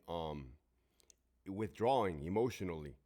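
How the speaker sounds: ordinary delivery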